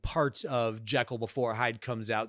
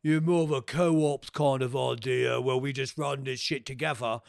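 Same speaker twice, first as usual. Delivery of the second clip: put-on Cockney villain, matey; Cockney attitude. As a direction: imitate a laddish Cockney inviting a mate along. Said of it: "he's got the Cockney accent".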